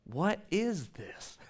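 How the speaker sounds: slow, soft baffled question; a hint of bewilderment; puzzled question. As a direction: wonder aloud slowly. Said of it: "which means".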